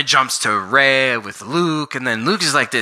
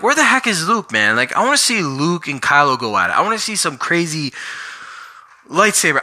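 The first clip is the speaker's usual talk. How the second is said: high-pitched, eager outburst, much higher; excited fan; 6 semitones higher than usual. Retold or imitated. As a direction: push it up like a fan who can't wait. Impatient fan demanding the fight.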